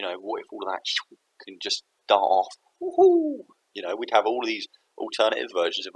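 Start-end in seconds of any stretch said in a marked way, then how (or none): 2.8-3.4 very high joyous whoop; cartoon whoop; gleeful whoop. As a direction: cheer as it darts away. Energy darting off gleefully.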